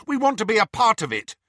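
loud, high-pitched insistence; angry. A narrator's reading. Neville insisting on joining the mission, angry. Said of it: "said Neville angrily".

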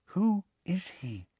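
slow, high, hushed question; whisper. A narrator's reading. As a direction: ask it slowly under your breath. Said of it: "she said in a whisper".

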